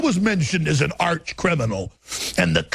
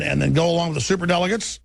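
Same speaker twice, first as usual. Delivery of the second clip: ordinary delivery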